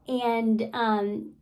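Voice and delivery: ordinary talk, nothing marked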